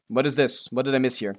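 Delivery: normal talking voice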